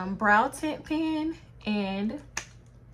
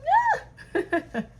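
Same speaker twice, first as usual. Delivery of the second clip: high-pitched giggle; short squealed giggle. Real, not acted. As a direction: let out a high, light laugh. Laughs after admiring her look.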